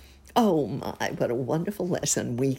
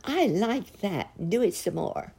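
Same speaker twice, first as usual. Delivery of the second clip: delighted; 5 semitones higher than usual. A narrator's reading. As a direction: savour it like a pleased child. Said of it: "clapped her hands delightedly".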